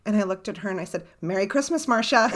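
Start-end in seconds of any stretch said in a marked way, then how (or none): 1.2-2.3 louder, lifting towards the name; hammed-up holiday cheer to break the gloom; mock cheery greeting. Retelling a greeting to mom after her sad card, mock cheer.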